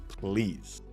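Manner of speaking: ordinary delivery, nothing marked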